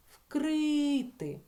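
"Вкрити" with the first И punched up and stretched; stress on И. Shown as a demonstration. Teacher reveals the check word, high, loud, held И in "Вкрити". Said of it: "наголос тепер падає на той звук".

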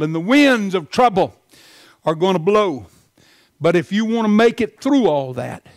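loud, high, fast preaching; fired-up sermon delivery; full-force preaching. Real, not acted. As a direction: preach it hard to the congregation.

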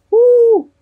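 loud high owl hoot; a sudden high hoot instead of a crow. A narrator's reading. Owl trying to crow, letting out a loud high hoot. Owl tries cock-a-doodle.

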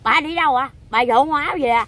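put-on loud, high scolding of the old woman; aunt scolding the mother, loud and high; retold scolding. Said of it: "cô nạt".

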